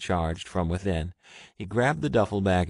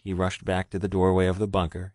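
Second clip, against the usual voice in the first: ordinary delivery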